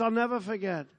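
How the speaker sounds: usual speaking voice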